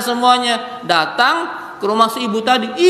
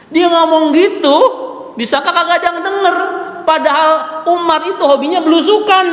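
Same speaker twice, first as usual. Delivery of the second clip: excited telling; 7 semitones higher, faster than usual. Real, not acted. Excited storytelling build.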